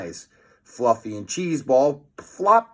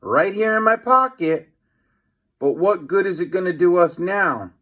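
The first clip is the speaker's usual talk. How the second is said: mild scream; 5 semitones higher, 3 dB louder than usual. A narrator's reading. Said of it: "screamed Cheese Ball".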